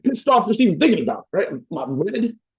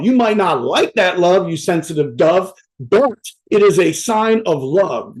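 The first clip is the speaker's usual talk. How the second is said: slow emphatic; slower than usual. Acted. Preaching point to audience.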